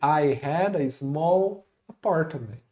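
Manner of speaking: very slow, evenly spaced English; slow model sentence. Shown as a demonstration. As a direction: say it slowly for learners to follow. Repeats the model for learners.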